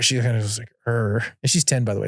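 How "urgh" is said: short imitated kid growl; frustrated growl. Dad imitating his frustrated daughter, a brief growl. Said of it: "So her growl's pretty intense".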